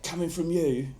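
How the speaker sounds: mimicking an irate Cockney fan; a touch of indignant Cockney outrage; mock angry reply. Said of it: "got a lot of angry".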